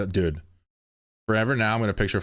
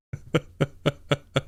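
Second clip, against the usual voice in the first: short breathy giggles, a bit higher; giggling; 7 semitones higher than usual. Real, not acted. Streamer giggling after pleading with his chat. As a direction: let a few giggles slip out.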